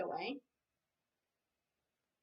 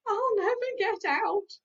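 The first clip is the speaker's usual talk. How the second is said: high, loud wail; howled despair; 8 semitones higher, 9 dB louder than usual. Acted. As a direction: howl in despair. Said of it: "howled Cowslip".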